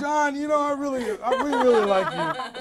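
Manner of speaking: loud, high, through laughter; laughing. Real, not acted. Host greets the returning prank caller, laughing. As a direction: laugh while telling the caller warmly.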